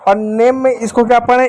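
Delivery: ordinary delivery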